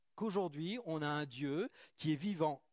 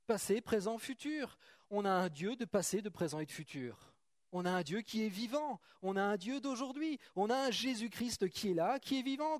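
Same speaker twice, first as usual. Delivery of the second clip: preaching with fire; 6 semitones higher than usual. Acted. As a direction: proclaim it to the congregation. Prêche montant en force. (prédication enflammée)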